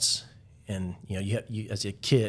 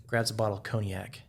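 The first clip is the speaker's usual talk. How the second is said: ordinary delivery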